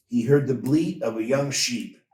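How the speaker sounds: ordinary delivery, nothing marked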